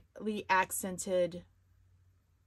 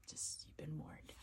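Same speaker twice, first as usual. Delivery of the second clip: hushed, breathy warning whisper; 4 semitones lower, 17 dB quieter than usual. Acted. Whispering a playful warning to her viewers. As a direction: lean in and whisper the threat. Ominous aside after wine warning.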